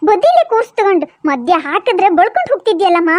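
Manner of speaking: put-on low old-woman voice, fed up; exasperated grandma. Grandma scolding Appu for leaving the baby, exasperated. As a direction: grumble like a grandmother at a naughty boy.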